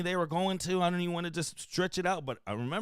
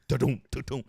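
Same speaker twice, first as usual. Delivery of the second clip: da-dum, da-dum, lower, a rhythmic hoof sound; galloping hoofbeats; 5 semitones lower than usual. Retold or imitated. Imitating Pujols' heavy footsteps with a lower galloping rhythm. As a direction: drum out heavy galloping steps.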